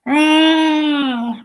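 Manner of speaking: high, drawn-out mock yell of frustration; frustrated yell. Teacher frustrated with distance learning leans back, mock frustrated yell. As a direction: imitate a teacher yelling in frustration. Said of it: "she just yells".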